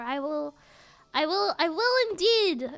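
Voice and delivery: ordinary talk, nothing marked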